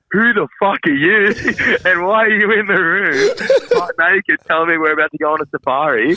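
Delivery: high-pitched, baffled demand; acted-out disbelief, laughing partway through; retold incredulous outburst. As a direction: re-enact being freaked out by a stranger, pitched well up. Retelling his shocked challenge to intruder.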